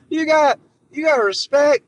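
pushed high and loud, missing the mark; a first try that overshoots; a botched Biden attempt, too shouty; failed Biden voice. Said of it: "that's not Joe Biden".

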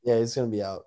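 normal talking voice

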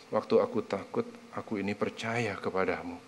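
soft, quiet read of the verse. Shown as a demonstration. Preacher softly reading the verse to the congregation, quiet. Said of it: "Coba lihat, keren nggak?".

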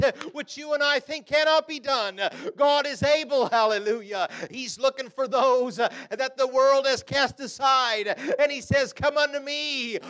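high, loud, rhythmic pulpit delivery; full-force preaching. Acted. Preacher urging the congregation that God is able, full-force preaching. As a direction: preach it hard, punching out each phrase.

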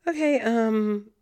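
slow, hesitant nervous okay; stall, unsure how to start; stammering. Retold or imitated. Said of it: "I stammered".

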